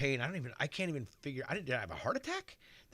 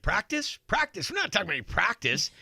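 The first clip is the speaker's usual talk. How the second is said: higher, louder mock rant, faster at the end; practice rant parody; 8 semitones higher, 7 dB louder than usual. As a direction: ham up the incredulous practice rant. Doctor riffs on famous practice rant.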